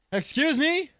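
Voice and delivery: very high rising question; alarmed challenge. Real, not acted. Player to whoever opened the door, alarmed challenge. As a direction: pitch it way up, challenging an intruder.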